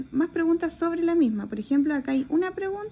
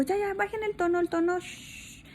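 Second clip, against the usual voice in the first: hush the class; 6 semitones higher, 6 dB quieter than usual. Retold or imitated. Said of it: "y les digo".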